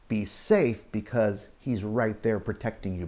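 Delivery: ordinary delivery, nothing marked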